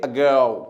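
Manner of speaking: "ago" low, weight on "go"; light "uh", press hard on "go" in "ago"; stress on second syllable. Shown as a demonstration. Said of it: "अ पे जोर नहीं देंगे". ("ago" में "अ" हल्का, "गो" पर ज़ोर)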